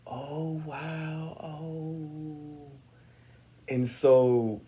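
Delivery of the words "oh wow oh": soft, airy awe, higher and softer; hammed-up awed reaction; feeling the room. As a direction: pretend to soak up the room's energy. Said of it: "I'm like".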